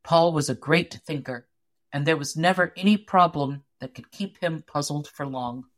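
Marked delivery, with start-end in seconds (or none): none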